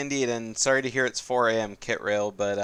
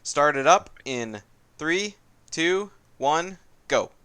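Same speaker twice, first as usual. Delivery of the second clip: countdown call; 5 semitones higher, 6 dB louder, slower than usual. Shown as a demonstration. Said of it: "counting racers down".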